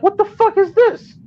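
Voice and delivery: acted high-pitched disbelief; incredulous outrage. Customer finding half a patty in the hero, incredulous. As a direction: re-enact being appalled, pitch shooting up. Retold outrage at tiny sandwich.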